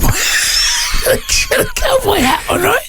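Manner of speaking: real laughter, high-pitched through the words; laughing. Real, not acted. Storyteller cracking up over the girl's cowboy hat, laughing.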